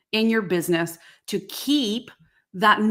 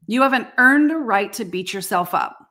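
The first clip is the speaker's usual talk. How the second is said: ordinary talk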